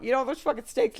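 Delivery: ordinary delivery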